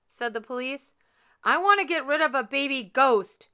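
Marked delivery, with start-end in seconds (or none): 1.4-3.5 loud, high exasperated shout. A narrator's reading. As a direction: shout it at someone who didn't hear. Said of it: "shouted Mister Frizzle".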